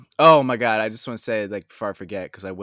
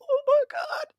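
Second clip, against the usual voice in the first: very high-pitched awestruck reaction; goosebumps awe; 4 dB louder, slower than usual. Retold or imitated. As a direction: re-enact getting goosebumps, voice shooting way up. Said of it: "gave me goosebumps".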